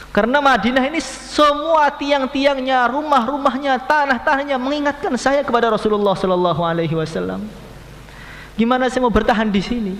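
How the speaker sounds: grieving Bilal. Acted. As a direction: beg to leave, full of longing. Bilal pleads to leave Madinah.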